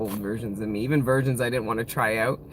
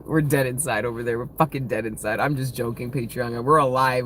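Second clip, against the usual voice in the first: usual speaking voice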